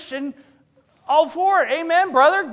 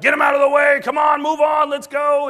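higher and louder barking orders; harsh trainer shout; 8 semitones higher, 9 dB louder than usual. Retold or imitated. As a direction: drill instructor yelling at recruits. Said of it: "The trainer's like".

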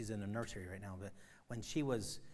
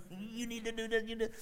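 higher, preachy urging voice; mock preacher; 10 semitones higher, 3 dB louder than usual. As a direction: imitate his own pulpit plea. Mock preacher addressing lost.

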